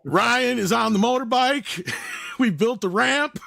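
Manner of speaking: high, slow mock commentary; stunt-show host. Narrating Ryan's motorbike jump, mock host announcement.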